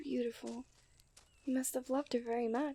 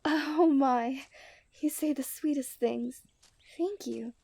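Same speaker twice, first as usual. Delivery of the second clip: loud, gushing "oh my", charmed by the compliment; flattered delight; 7 dB louder, slower than usual. Acted. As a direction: play it like a blushing princess caught off guard by sweetness.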